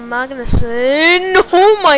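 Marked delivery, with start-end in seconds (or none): 1.5-2.0 surprised exclamation. Real, not acted. Sudden incident in race commentary.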